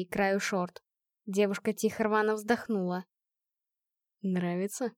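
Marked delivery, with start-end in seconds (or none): 4.2-4.9 soft, low murmur. A narrator's reading. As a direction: murmur it close and quiet. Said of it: "пробормотал Хью".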